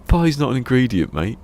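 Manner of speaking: high, loud exasperated telling-off. Retold or imitated. Greg Davies telling off the driver, loud exasperation. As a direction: scold him like he's mad.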